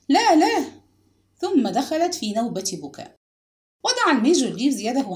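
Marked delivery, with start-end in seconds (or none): 0.1-0.7 high, loud cry of refusal; anguished outcry. A narrator's reading. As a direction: cry out as a distraught mother. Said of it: "انفجرت السيدة ريفز تصيح". (صيحة رفض ملتاعة)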